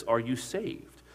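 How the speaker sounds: a slow, measured retold question, held back; slow probing question. Crewman quietly asks the new gunner, slow and searching.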